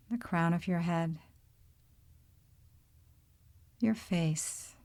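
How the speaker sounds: calm, unhurried meditation voice with long pauses; guided meditation. Real, not acted. Yoga teacher leading listeners into body awareness, calm guided-meditation delivery. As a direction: guide them gently through the body, leaving space between phrases.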